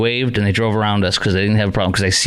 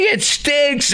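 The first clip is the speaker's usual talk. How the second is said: much higher hammed-up yelled complaint; mock angry passenger. Host mimics the passenger complaining, high mock shout. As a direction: squeal it like the woman on the plane. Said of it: "she's like".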